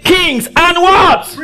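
slow, high, ringing scripture proclamation; full-force preaching. Real, not acted. Preacher leading the church through a verse, fervent high call-and-response. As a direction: stretch the key words and call for answers.

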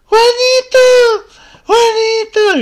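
very high, slow shrieking grandma call; old lady yelling. Retold or imitated. Grandma yelling for Juanito, very high drawn-out shriek. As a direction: screech the name like a stoned granny. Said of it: "Se puso a gritar la señora".

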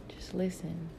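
breathy hushed whisper. Real, not acted. Whispering the advice to her listeners. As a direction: whisper it like a secret.

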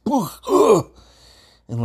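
sharp gasp. Retold or imitated. Fall in muskeg hole.

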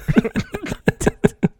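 high loud cackling laugh; big burst of laughter. Real, not acted. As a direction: laugh out hard at the punchline. Punchline about dad's farts.

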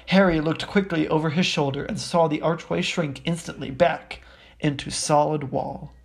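plain reading voice

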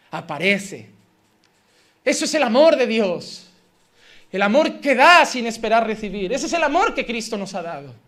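ordinary talk

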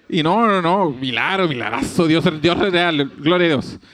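lightly hammed-up churchgoer praising God; a hint of preachy praise, slowing on Dios es real; mock devout bystander. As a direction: play the onlookers calling it a miracle, half-joking. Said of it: "Todos bien cristian".

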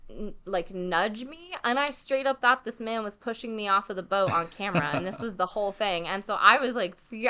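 ordinary talk, nothing marked

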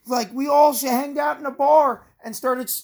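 high, hyped bro voice; mock founding fathers. Host voices drunk founding fathers at a bar, high and hyped. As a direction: play the drunk revolutionaries as bros.

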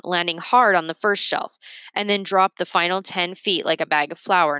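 ordinary delivery

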